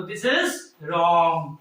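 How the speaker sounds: this is wrong drawn out low and slow. Shown as a demonstration. Teacher slowly, lowly marking a student's wrong plural. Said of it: "गलती से भी आपने S जोड़ा".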